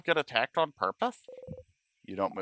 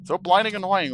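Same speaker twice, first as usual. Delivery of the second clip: angry snap; 7 semitones higher, 6 dB louder than usual. Acted. Acted quarrel with Odile.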